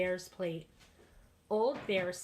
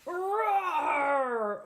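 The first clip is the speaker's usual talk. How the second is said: big bellowing animal roar; loud bear roar; 7 semitones higher, 7 dB louder than usual. A narrator's reading. The bear roars at Baba Brena, loud bellowing roar. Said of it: "Old Bear roared".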